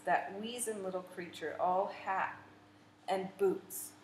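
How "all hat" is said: ordinary delivery, nothing marked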